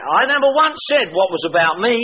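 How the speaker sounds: normal talking voice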